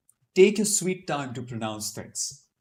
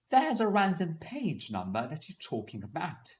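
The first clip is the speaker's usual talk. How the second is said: higher-pitched British RP demo; non-rhotic British English, R only before vowels; British RP accent; 5 semitones higher than usual. Shown as a demonstration. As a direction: put on British received pronunciation. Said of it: "Something like that".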